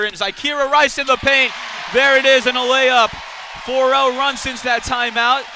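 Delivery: high, very loud play-by-play; excited layup call. Real, not acted. Commentator calls Rice's layup for Gonzaga, excitedly. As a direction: belt out the basket as it drops.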